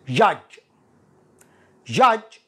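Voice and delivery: usual speaking voice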